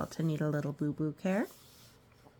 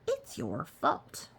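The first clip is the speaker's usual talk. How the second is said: high, slow accusing creature voice; 6 semitones higher, 3 dB quieter than usual. Acted. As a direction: point the finger in a put-on voice. Accusing alligator voice.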